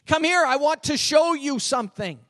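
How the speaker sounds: insistent and emphatic; emphatic summons. Acted. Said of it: "he's saying".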